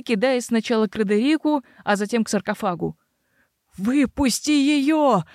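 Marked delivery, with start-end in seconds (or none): 3.8-5.2 high, drawn-out straining shout; straining yell. Acted. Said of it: "надрывался Грегор". (надрывный крик)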